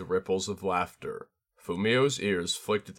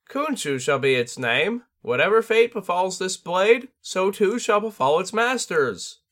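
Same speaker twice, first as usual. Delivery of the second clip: high, rising solemn bird voice; a bird spirit foretelling fate; prophesying tengu; 11 semitones higher, 4 dB louder than usual. A narrator's reading. Tengu naming the sword in a high solemn prophecy.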